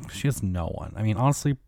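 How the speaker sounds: ordinary delivery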